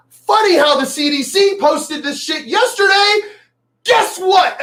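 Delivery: high, loud sarcastic outburst; sarcastic shout. Real, not acted. Vlogger sneering at the CDC, high and loud. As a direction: yell it with mocking disbelief.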